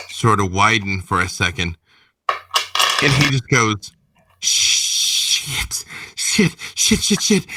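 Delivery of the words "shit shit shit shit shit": frantic breathy whispered curses, climbing much higher; a thug hissing in sudden terror; panicked cursing. Acted. Panicked thug seeing the Primogen.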